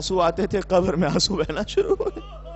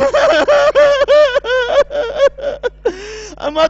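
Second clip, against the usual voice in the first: put-on a child's voice, higher, rising into a loud anguished cry; sobbing child; 7 semitones higher, 8 dB louder than usual. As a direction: cry out to mother as a grieving child. Crying child asks mother. (روتے بچے کی اونچی دردناک آواز میں ماں سے سوال)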